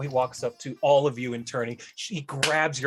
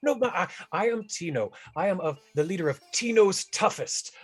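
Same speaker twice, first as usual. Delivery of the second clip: higher, excited greeter gushing yes yes; 6 semitones higher than usual. Acted. [name] greets the party, high and gushing. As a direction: fawn over the tall guest like an overjoyed host.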